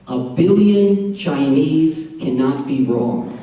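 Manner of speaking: very slow, deliberate declaration; mock-solemn, drawn-out proclamation; slow punchline. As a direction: lay each word down slowly.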